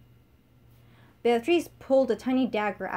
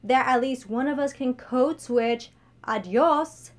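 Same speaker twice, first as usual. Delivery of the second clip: louder and slowed, smug parting shot; smug taunt; 3 semitones higher, 6 dB louder, slower than usual. Acted. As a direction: land it slowly and smugly.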